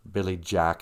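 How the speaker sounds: ordinary talk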